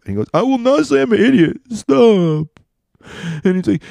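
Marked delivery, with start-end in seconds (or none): 0.3-2.5 high-pitched mock whine, a little louder; bullied kid's protest. Little brother pinned under his brother refuses, high strained mock wail.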